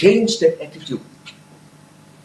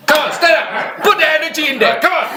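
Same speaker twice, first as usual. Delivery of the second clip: rallying shout; 13 semitones higher than usual. Real, not acted. Laughing yoga leader getting the audience on their feet, rallying shout. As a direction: rouse the whole room to stand up. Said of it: "I want to teach you how to laugh".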